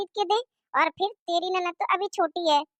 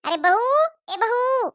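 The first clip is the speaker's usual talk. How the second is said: calling out; 7 semitones higher, 5 dB louder, slower than usual. Acted. Mother-in-law calls daughter-in-law.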